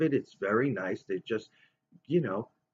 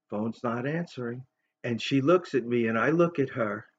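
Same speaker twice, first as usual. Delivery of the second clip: ordinary talk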